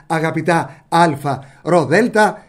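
ordinary talk

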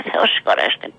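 low whispered blessing; a beggar's hushed thanks. Retold or imitated. Beggar blesses while eating.

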